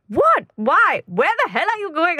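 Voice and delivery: high-pitched mock-indignant questions; mock exasperation, pitched well up; indignant questioning. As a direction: imitate an exasperated parent demanding answers. Acts out mother's indignant questioning.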